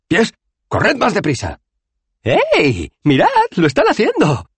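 high, loud amazed cries; excited exclaiming. A narrator's reading. Rincewind orders his feet to run, giddy and loud. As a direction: call out in giddy astonishment.

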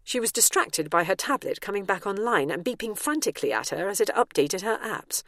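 plain reading voice

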